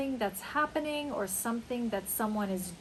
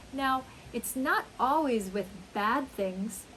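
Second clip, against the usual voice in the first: ordinary talk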